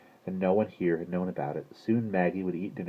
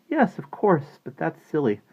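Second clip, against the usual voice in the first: high, brisk character voice; reassuring girl; 11 semitones higher, 5 dB louder than usual. A narrator's reading. Maggie reassures the little sisters, high dismissive answer. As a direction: brush it off in a raised pitch.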